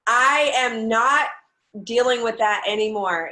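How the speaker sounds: loud, emphatic "I am not"; defiant emphasis. Real, not acted. Speaker rejecting other people's opinions, defiant and emphatic.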